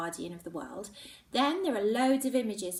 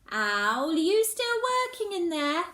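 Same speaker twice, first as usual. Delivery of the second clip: Mum calling up from downstairs, raised and high; 9 semitones higher, 8 dB louder than usual. Acted. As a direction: call through the house to her daughter. Mum calling from another room.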